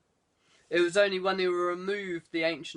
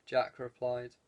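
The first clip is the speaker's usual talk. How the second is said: ordinary delivery, nothing marked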